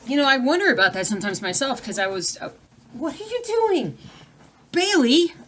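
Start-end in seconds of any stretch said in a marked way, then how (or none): 2.9-5.3 high, loud call to the dog. Real, not acted. Owner catches the dog misbehaving, high loud call. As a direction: stop the dog mid-thought. Scolding dog mid-sentence.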